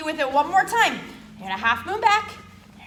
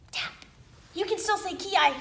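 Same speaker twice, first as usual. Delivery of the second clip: ordinary delivery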